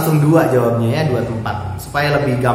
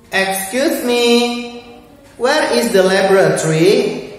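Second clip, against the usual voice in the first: put-on child asking; 7 semitones higher than usual. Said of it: "niru suara ibu-ibu".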